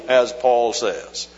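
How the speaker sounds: ordinary talk, nothing marked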